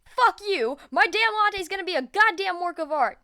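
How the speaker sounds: high, loud, fiery boast; furious, proud shouting; angry yell. Acted. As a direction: yell it like a dare accepted. Bakugo challenged, yells back.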